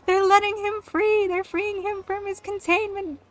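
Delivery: high-pitched breathless imitation of a thrilled friend; excited friend. Friend announcing a character's release, mock-excited. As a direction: gush the news in a high voice.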